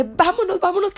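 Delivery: high, urgent repeated plea; urgent, rapid. Retold or imitated. Urging her sister to drive off, panicked. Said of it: "pero rápido".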